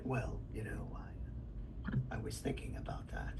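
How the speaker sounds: hushed, low, near-whispered musing; underplay it, barely above a whisper; realistic character voice. Shown as a demonstration. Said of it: "they want the reality of the character".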